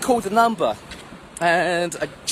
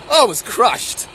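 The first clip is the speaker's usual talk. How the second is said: raised, louder outburst of dismay; crushed exclamation; 5 semitones higher, 6 dB louder, slower than usual. Retold or imitated. Man recalling his teacher's verdict on his dream, exclaiming in dismay. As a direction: exclaim it high and loud, looking back in hurt.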